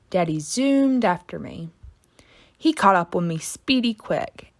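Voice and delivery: usual speaking voice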